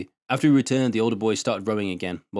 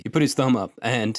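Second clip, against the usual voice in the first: plain reading voice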